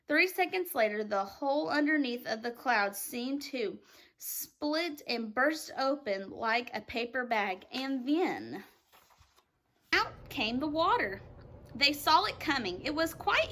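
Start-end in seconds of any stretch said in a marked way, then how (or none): none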